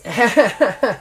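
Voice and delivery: short amused chuckle; mocking laugh. Rival chuckles at the champion's promo. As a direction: laugh at him lightly. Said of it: "he just goes".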